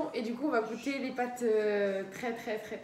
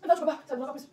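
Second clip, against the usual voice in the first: mouth full of hot food; 2 semitones higher, 4 dB louder, much faster than usual. Real, not acted. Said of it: "c'est déjà irrité".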